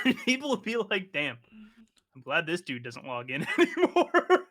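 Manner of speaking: loud, laughing as he talks; can't hold the laugh while telling the joke; laughing through it. Real, not acted. Host joking people would be glad he quit, laughing loudly.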